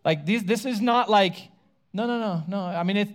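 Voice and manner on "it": ordinary delivery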